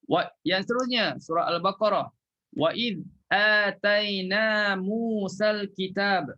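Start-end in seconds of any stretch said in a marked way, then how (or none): 2.6-6.3 slow chanted Quran recitation; tajwid recitation. Shown as a demonstration. Ustaz reciting to his class, slow melodic chant. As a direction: recite it slowly, stopping at kitab. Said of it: "Kalau kita sambung".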